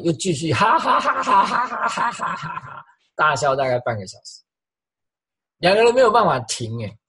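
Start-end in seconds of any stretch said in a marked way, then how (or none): 0.5-2.9 high-pitched rolling mock laugh; imitated helpless laughter. Preacher retells couple laughing on the floor, high mock laughter. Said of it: "两个人又笑在地上".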